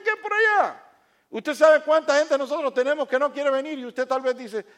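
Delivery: loud, high, emphatic sermon; fiery preaching. Acted. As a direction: preach it at full force to the congregation. Preacher rises to full force.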